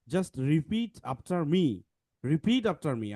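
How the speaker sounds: slow and soft, word by word; slow instruction. Shown as a demonstration. Teacher telling students to repeat after him, slowly. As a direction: spell out the instruction patiently. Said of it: "আমি যখন পড়বো".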